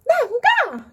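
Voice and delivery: high, loud tantrum yell; sudden high-pitched shout of refusal; retold drunken refusal. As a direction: shriek it out like a stubborn drunk sister. Drunk sister refusing to go home.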